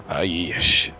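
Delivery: ordinary talk, nothing marked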